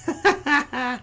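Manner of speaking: high-pitched, happy laugh; a real burst of delighted laughter. Real, not acted. Host thrilled his cousin is watching, delighted laugh.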